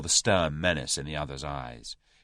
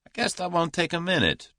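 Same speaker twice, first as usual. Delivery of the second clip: drawled; 12 semitones higher than usual. A narrator's reading. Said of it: "drawled Julius".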